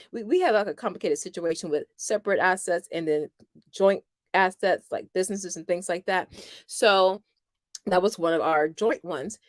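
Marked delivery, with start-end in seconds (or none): none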